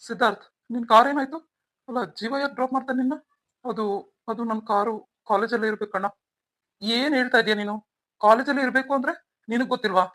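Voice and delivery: loud, demanding questions; sharp interrogation of the brother; angry questioning. Acted. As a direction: grill him for answers. Family grilling him about car.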